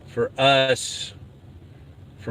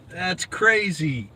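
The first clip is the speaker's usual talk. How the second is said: high, drawn-out amazement; slow playful exclamation; mock disbelief; 7 semitones higher, much slower than usual. Real, not acted. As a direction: stretch it out, pitched up, incredulous. Teasing over the unplugged phone.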